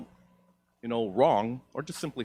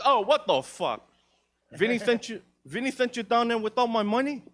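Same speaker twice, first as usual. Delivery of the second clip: put-on Italian-American accent; 11 semitones higher, 3 dB louder than usual. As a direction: play a New York Italian mobster, fake tough. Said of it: "I responded with an Italian-American accent".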